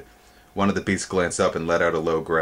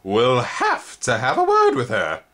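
slow, louder insect voice, a touch stern; 5 semitones higher, 5 dB louder, slower than usual. A narrator's reading. Spindlebug deciding to complain, slow stern insect voice. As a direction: lay down the law slowly.

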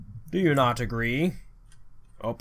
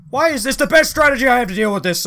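exasperated outburst; 9 semitones higher, 8 dB louder, faster than usual. Real, not acted. Frustrated at game strategy.

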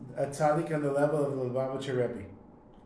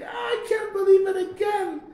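a shrieking rage over the spilled milk; furious screaming. Retold or imitated. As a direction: scream it like a man losing his temper. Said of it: "goes crazy and starts screaming".

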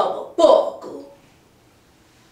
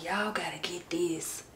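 hushed excited whisper; 10 dB quieter than usual. Real, not acted. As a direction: whisper it to your viewers like a secret. Excited aside to viewers.

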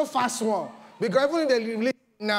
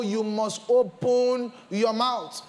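ordinary delivery

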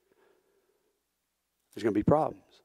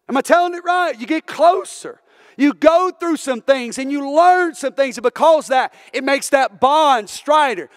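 high, loud, rising sermon climax; full-force preaching; 10 semitones higher, 5 dB louder than usual. Real, not acted. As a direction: preach it out to the whole church.

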